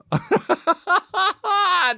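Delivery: high burst of laughter; laughing at the crying-wife bit; high cackle. Real, not acted. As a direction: cackle at your own joke. Laugh at own joke.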